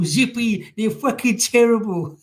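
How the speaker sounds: high, slow, sulky puppet voice; drawn-out mournful puppet reply; George from Rainbow. Retold or imitated. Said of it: "You go".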